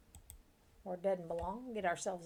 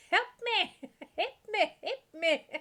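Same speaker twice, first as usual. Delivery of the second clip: mock cry for help; 11 semitones higher, 7 dB louder than usual. Cook keeps calling for help.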